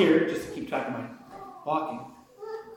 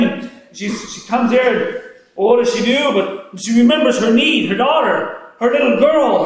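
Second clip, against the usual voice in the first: loud, high, driving preaching; preaching at full force; 6 semitones higher, 6 dB louder than usual. Acted. Preacher telling the congregation her story, full fervor. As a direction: build the fire as you tell her story.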